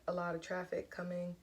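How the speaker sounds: usual speaking voice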